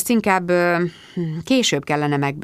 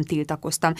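ordinary delivery, nothing marked

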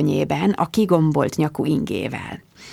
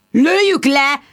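high, loud scornful squire; scornful shouting; 6 semitones higher, 3 dB louder than usual. Acted. Squire scoffs at Bridget's plush animal idea, angry. Sir Hugo mocking her idea.

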